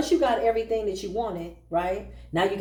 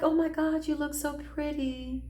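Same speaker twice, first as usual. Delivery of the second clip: high, gushing mock compliment; fake flattery; 7 semitones higher than usual. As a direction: play the witch sweet-talking her. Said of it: "They're like".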